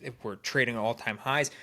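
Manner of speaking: ordinary talk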